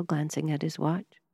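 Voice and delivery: usual speaking voice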